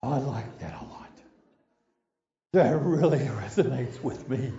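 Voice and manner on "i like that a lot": whispered. Real, not acted. Savoring his own story.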